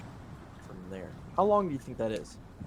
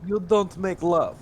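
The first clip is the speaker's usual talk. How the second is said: put-on loud, slow hammy Russian accent; 5 semitones higher, 7 dB louder than usual. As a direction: slowly boom in a thick Russian accent. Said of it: "thick Russian accent".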